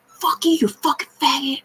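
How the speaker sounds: high, hissed whisper of a sneering woman; whispered mock insult. Imitating Tiffany's sneer at the entities, whispered high. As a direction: spit it out under the breath in a high voice. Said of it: "she's like".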